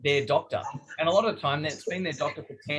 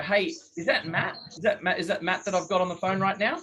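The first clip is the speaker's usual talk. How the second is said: higher, with a laugh in the voice; casual and friendly; 4 semitones higher than usual. Shown as a demonstration. Sales coach showing a friendly phone opener, higher and laughing. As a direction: sound like an old mate calling. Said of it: "if you just turn around and go".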